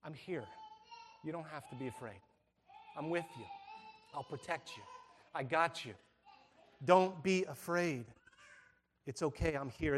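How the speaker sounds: put-on soft, tender reassurance to a scared child; father soothing his frightened son at night; loving father. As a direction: hush him gently like a father at bedside. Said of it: "loving father would come into the bedroom".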